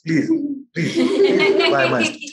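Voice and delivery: squeaky high, slow, laughing sales pitch; mock a begging street seller, way up high; hammed-up pushy salesman. Teacher mocking the mind as a street salesman, hammed-up squeaky plea. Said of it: "It's like the salesman".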